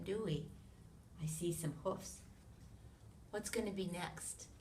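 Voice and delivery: ordinary talk, nothing marked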